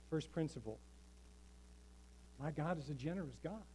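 ordinary talk

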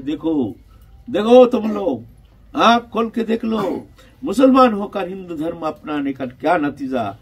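shouted proclamation. Retold or imitated. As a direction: call out a warning to the crowd. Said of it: "वो चिल्ला के कहते हैं".

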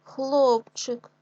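teacher's stressed-vowel demo of хлопчик. Shown as a demonstration. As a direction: punch and stretch the о in хлопчик.

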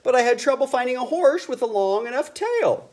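high, loud critter voice; raccoon voice. A narrator's reading. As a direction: pop in as a raccoon.

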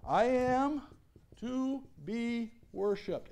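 drawn out very slowly, words weighed; solemn slow declaration. Acted. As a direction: let every word land, slow and grave. Pastor voices Jesus' claim.

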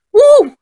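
high, loud "woo"; excited whoop. Acted. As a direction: let out a high-pitched cheer. Cheering before the movie starts.

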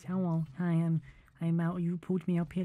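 soft put-on Malga hello; light Malga imitation; Malga voice demo. Shown as a demonstration. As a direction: show how Malga speaks. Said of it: "He speaks like this".

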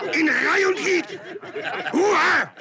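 shout. Acted. Sergeant yelling orders.

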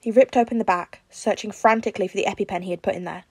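usual speaking voice

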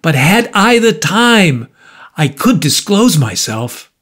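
high, impassioned outpouring; cried out. A narrator's reading. Markheim defending his life to the visitor, crying out. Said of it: "cried Markham".

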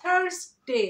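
drawn-out "THARS-day". Shown as a demonstration. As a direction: sound the r in থার্সডে, falling on day. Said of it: "আমরা কি বলি?".

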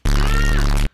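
short high, very loud scream; real piercing yelp of dismay; startled scream. Real, not acted. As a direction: scream out in sudden alarm. Sudden mishap while crafting.

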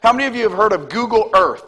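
usual speaking voice

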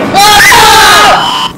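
high loud scream; startled scream. Real, not acted. Player startled by monster, high loud scream. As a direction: scream at the sudden scare. Scare in horror game.

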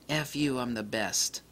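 the lens brags quietly in your ear; cocky lens voice. Retold or imitated. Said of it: "it goes".

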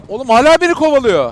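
high, loud panicked complaint; being chased. Real, not acted. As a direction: panic while fleeing. Spider still chasing. (kovalanırken panikle)

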